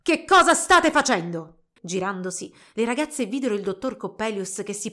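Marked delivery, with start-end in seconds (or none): 0.0-1.6 high, loud, drawn-out angry demand; furious yelling. A narrator's reading. Old inventor catching girls in his workshop, yelling furiously. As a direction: bellow at intruders in outrage. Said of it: "sbraitando rabbiosa".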